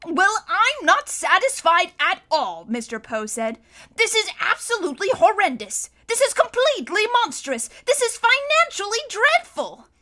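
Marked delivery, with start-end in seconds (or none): 0.1-2.6 loud, high, slow indignant protest; indignant banker. A narrator's reading. Banker protests the wedding outcome. 4.0-9.9 high, loud indignation climbing higher with each phrase; mounting outrage. A narrator's reading. Banker's protest mounting in outrage.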